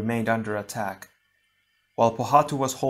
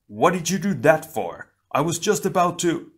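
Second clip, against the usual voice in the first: indignant protest; 6 semitones higher, 4 dB louder than usual. A narrator's reading. Startled Tahu protests mid-battle.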